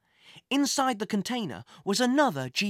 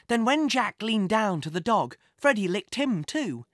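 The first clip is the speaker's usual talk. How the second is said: ordinary delivery, nothing marked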